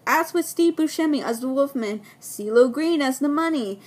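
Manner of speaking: normal talking voice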